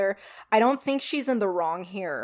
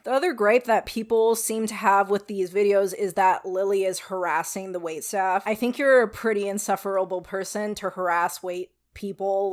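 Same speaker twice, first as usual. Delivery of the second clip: normal talking voice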